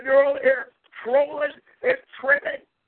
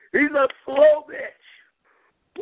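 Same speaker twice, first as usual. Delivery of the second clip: mocking; 2 semitones higher, much slower than usual. Mocking insult, drawn out.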